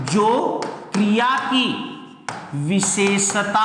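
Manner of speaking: syllables stretched out, pauses between pieces; slow, deliberate definition; slow dictation. Shown as a demonstration. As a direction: dictate it slowly for students writing it down. Teacher dictates definition slowly.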